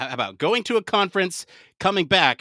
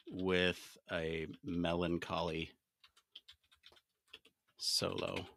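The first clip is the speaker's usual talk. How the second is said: slow, low and much softer, in pieces; absorbed muttering; 11 semitones lower, 13 dB quieter, slower than usual. Real, not acted. Host entering the song prompt, absorbed slow murmur. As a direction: murmur it slowly with long gaps, as if typing.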